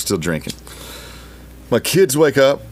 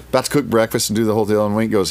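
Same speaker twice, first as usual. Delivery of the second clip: ordinary delivery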